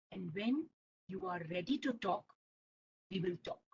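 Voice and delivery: very low, soft and patient; hushed, measured reassurance; calm grandpa. Acted. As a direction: speak gently to an upset child. Grandpa calmly ends the quarrel.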